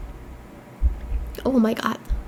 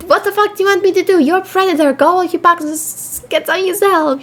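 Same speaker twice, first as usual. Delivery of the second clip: taunting game voice; 6 semitones higher than usual. Acted. Voicing the game's taunting character.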